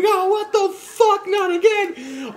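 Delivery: high, slow howling cry of the lion. Acted. A lion wailing at the gorilla, high slow howl. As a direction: wail it out like a wounded lion. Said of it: "Lion's howling".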